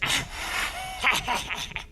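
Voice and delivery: breathy, wordless fit of giggles. Acted. Organist giggling at the host's backhanded compliment, tittering. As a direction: giggle under your breath at the host. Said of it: "Got the giggles, do we, Agnes dear?".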